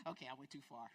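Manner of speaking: sheepish self-correction; mock sheepish. Preacher retells answering her husband, sheepish soft. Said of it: "I'm like".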